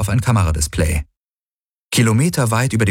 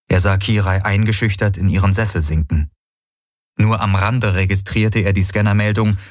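ordinary delivery, nothing marked

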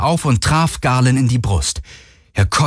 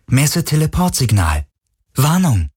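lightly voiced ship's warning, pitched higher; ship's alert voice; 4 semitones higher than usual. A narrator's reading. Jezebel reports a teleport signal to the crew, lightly voiced alert. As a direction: read it as an automatic alert. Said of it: "meldete die Jezebel".

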